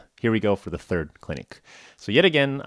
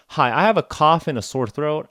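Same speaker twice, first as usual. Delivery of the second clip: eager opening; 9 semitones higher, 6 dB louder than usual. Retold or imitated. His rushed list to clinic.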